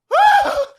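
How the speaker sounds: very high, loud mock-panicked squeal; sudden hammed-up shriek of exertion; frantic running noise. Woman racing to the post office, frantic squeal. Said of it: "like, just frantically running".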